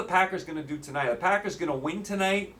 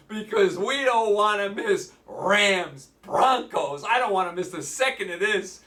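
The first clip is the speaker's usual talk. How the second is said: roaring rallying speech; 5 semitones higher, slower than usual. Acted. Sarcastic rallying call.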